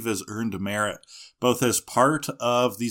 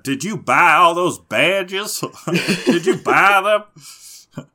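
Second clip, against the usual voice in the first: higher, louder, slow hokey Southern sneer; hokey Southern accent; 7 semitones higher, 6 dB louder than usual. Retold or imitated. Co-host imitates AJ taunting Ash, in a hokey Southern accent. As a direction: jeer in a hammed-up Southern accent. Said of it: "he has this really hokey accent".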